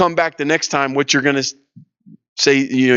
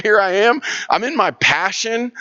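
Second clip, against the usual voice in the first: higher-pitched, elated quote; delighted retelling; 7 semitones higher than usual. Retold or imitated. Tailor describing his work in jail, retold with delight. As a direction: voice someone thrilled to be doing what they love.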